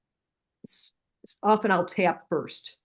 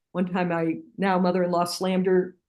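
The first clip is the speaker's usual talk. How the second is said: ordinary talk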